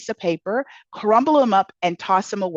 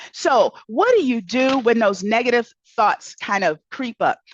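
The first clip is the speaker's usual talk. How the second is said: ordinary talk, nothing marked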